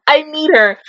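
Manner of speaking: normal talking voice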